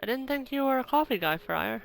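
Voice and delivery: normal talking voice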